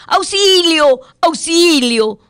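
high, loud desperate call for help; cry for help. Acted. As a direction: shout for help like a drowning man. Drowning man cries for help.

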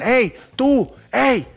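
shouted hey. Retold or imitated. Passerby shouting hey at a stranger, high mock yell. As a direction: yell at someone to turn. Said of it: "si gritan".

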